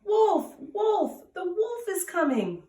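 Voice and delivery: high, loud fake alarm, easing at the end; shouting. Bored shepherd boy tricks the villagers again, high loud shout. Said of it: "again started shouting".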